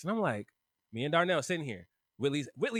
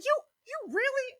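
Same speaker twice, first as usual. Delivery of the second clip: high, stammering indignant voice; outraged imitation; 12 semitones higher than usual. Whitley scolds Cass for deleting her game, high mock outrage. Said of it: "Meant that with her whole chest".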